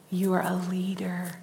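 soft, unvoiced, intimate; whisper. Real, not acted. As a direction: breathe it to each listener. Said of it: "preacher whispers to audience".

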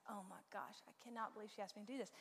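put-on soft, fast, muttered teen complaint; eye-rolling kid. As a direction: grumble under your breath like an annoyed teen. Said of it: "rolled his eyes and was like".

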